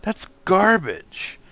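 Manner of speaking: loud, high, fast angry rant; frustrated yell. Real, not acted. Gamer yelling at the mobs while getting hit, loud and high. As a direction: shout it out at the unfair game.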